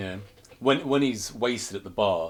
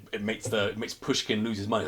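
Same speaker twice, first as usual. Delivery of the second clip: ordinary talk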